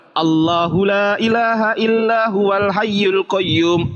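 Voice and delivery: slow chanted verse; Quran recitation. Shown as a demonstration. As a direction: recite it slowly like a Quran reading. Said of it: "contoh di dalam Al-Quran".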